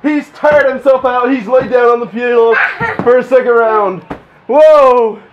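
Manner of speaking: high, loud sportscaster voice; play-by-play commentary. Acted. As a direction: call the fight like a wrestling match. Dad commentates pillow fight.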